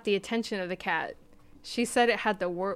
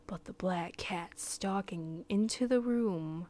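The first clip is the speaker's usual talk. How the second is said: slow spooky whisper; 3 dB quieter, slower than usual. Acted.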